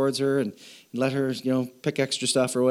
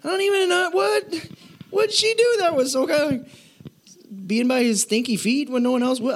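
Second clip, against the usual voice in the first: much higher, baffled mock reader; 10 semitones higher than usual. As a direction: squeak out total confusion at the text. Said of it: "I'm like".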